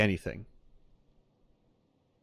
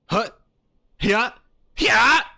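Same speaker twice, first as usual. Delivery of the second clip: Link; 11 semitones higher, 8 dB louder, slower than usual. Retold or imitated. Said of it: "his voice lines are".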